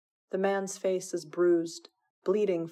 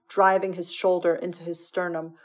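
ordinary delivery, nothing marked

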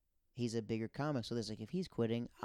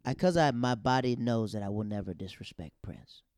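ordinary talk

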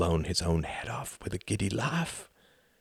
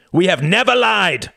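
higher, louder statement; firm denial; 12 semitones higher, 9 dB louder than usual. Acted. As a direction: state it coldly first. Count insists, before voice rises.

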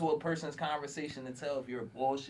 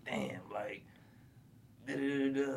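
mock whisper; 6 dB quieter than usual. Imitating a racist's inner thoughts.

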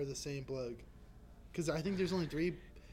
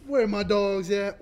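slow, louder hype call; 4 semitones higher, 10 dB louder, slower than usual. Pretend. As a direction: call out to the crowd, slow and loud. Calling out to the crowd.

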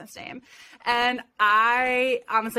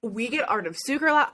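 normal talking voice

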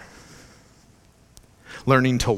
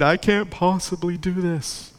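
whiny complaint; 7 semitones higher, slower than usual. Acted. Believer collapsing under God's burden.

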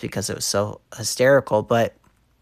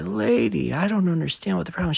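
high, fast mock-clueless whine; clueless guy; 9 semitones higher, faster than usual. Retelling a porn scene, lightly voiced clueless guy. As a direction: play the dumb guy in the tub, hurried. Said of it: "he's like".

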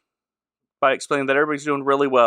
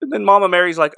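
ordinary delivery

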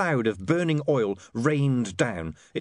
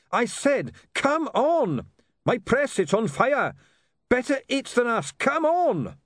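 urgent shouting; 12 semitones higher, slower than usual. Acted. Urging Goodmountain out of fire.

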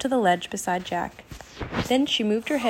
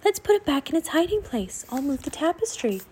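high, lightly voiced raven; croaking raven; 6 semitones higher, 3 dB quieter than usual. A narrator's reading. Raven Annie urging Jack to hide it, high and lightly croaked. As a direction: pitch it up with a hint of croak. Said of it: "croaked Annie".

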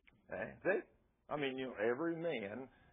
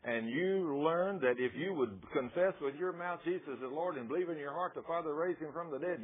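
normal talking voice

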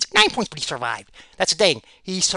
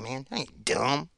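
ordinary talk, nothing marked

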